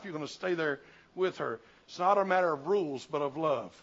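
ordinary talk, nothing marked

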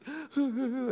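high, put-on crying sobs; imitated burst of weeping; mock sobbing. Pastor imitates the man suddenly crying, mock sobbing. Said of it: "Ya, gitu nangis".

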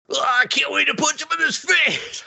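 high, gleeful mock yell; eager shout. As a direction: shout it like he can't wait to fight. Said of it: "Connie was just like".

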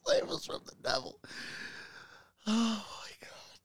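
high breathy whisper mimicking her plea; hushed mocking whisper. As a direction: whisper it like a spooked prayer. Mocking the TikTok lady's plea.

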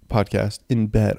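ordinary delivery

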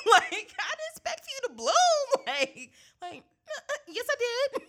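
laughing high and loud through the words. Real, not acted. Laughing at the surprised hater while telling the podcast host.

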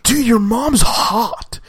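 high, drawn-out surfer-bro voice; slow, exaggerated dude drawl; Bill and Ted. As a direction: stretch it like a Valley surfer dude. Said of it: "what's the Bill and Ted's accent?".

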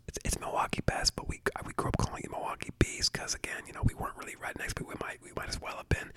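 hushed, half-whispered correction to yourself; quiet aside. Real, not acted.

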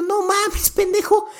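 high-pitched disbelieving cry; squealing no mames in shock; shocked outburst. Retold or imitated. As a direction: shriek it in horror at the screen. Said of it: "Y yo".

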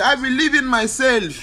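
put-on high, laughing little-boy quote; laughing imitation of son. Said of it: "He said".

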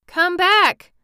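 high, loud urgent shout; full-voiced calling out. A narrator's reading. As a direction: yell after it to return. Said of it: "shouted the boy again".

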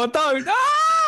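loud frustrated outburst; frustrated shout. Real, not acted. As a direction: shout in exasperation. Frustrated at forgetting.

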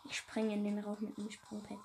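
hushed whisper to herself; sneaky whispered play. Real, not acted. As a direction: breathe the words secretly. Whispered game commentary.

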